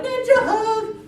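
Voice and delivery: high loud squeal; excited girls. Retold or imitated. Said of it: "two girls run up to me and they're like".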